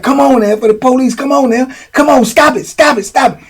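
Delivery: high, urgent pleading to stop. Retold or imitated. Charles trying to calm Boo Boo, high urgent pleading. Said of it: "And then Charles like".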